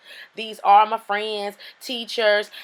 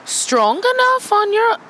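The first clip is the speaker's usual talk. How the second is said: put-on British accent; 8 semitones higher than usual. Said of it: "I had to get rid of that British accent".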